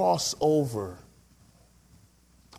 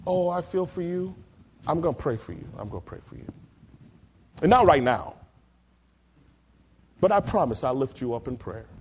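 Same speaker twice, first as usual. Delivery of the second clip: a lightly voiced hollow churchgoer; hint of an empty pious brush-off; mock pious promise. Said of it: "people who don't have the third C say things like this".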